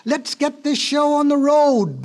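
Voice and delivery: high, slow, sustained holler; bellowing. A narrator's reading. Ox starting the race for all the animals, bellow. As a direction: bellow it out with long vowels. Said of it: "bellowed the ox at last".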